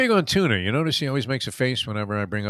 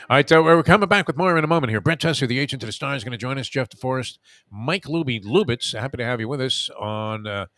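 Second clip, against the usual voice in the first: upbeat, louder show-promo delivery; radio host read; 3 semitones higher, 3 dB louder than usual. Acted. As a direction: announce the lineup like a radio host.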